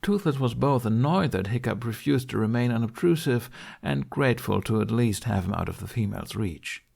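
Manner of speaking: plain reading voice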